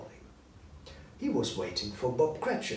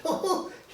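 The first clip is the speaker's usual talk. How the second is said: high rising chuckle; high delighted chuckle; gleeful old man laugh. Acted. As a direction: cackle with delight. Scrooge laughs to himself.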